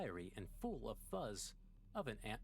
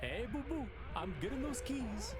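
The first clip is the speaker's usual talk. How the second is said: higher, louder cartoon bear voice; chirpy cartoon bear announcing; Yogi Bear voice; 11 semitones higher, 3 dB louder than usual. Gamer grabs keys as the cartoon bear, louder and higher. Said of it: "Yogi, Yogi".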